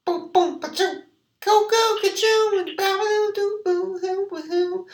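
very high babbling scat; high silly voice. Pretend. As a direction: bounce the nonsense syllables up high. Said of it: "don't change your voice".

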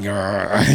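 low, soft imitated dog growl; lightly imitated growl. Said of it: "and this dog's just, like, kinda like".

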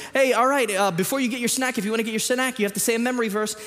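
high-pitched imitation of a teacher addressing kids; Sunday school teacher. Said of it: "our Sunday school teacher would be like".

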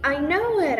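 loud eager shout. Acted. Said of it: "I shouted".

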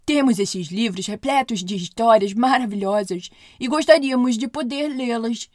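loud, put-on voice of the master dwarf; projected character voice. A narrator's reading. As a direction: explain eagerly as the dwarfs' leader. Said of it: "perguntou o mestre".

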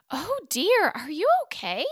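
high, loud startled concern; alarmed. Acted. Boney seeing Frankenstein's arm fall off, high and startled. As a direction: gasp it out in alarm.

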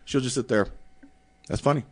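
normal talking voice

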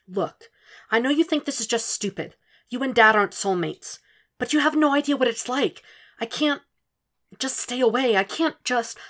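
put-on high, pleading young woman; pleading daughter. A narrator's reading. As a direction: beg her mother to understand. Pleading with mother on phone.

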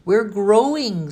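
loud, high, drawn-out cheeky excuse; cheeky kid's protest; smirking. A narrator's reading. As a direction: stretch it out slowly with a smirk. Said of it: "smirked Danny".

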